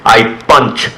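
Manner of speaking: ordinary talk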